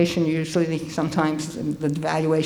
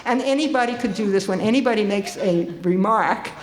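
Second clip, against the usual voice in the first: usual speaking voice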